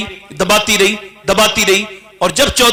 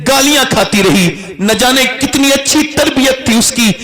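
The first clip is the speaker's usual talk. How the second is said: ordinary delivery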